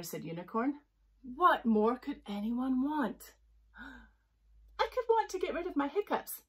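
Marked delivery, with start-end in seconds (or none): 1.2-3.3 slow pompous. A narrator's reading. Unicorn pompous. 4.8-6.4 high complaining. A narrator's reading. Jellyfish hiccuping.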